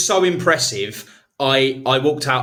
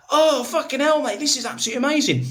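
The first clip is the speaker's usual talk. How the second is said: amazed gasp; 12 semitones higher than usual. Pretend. Imagined guy amazed.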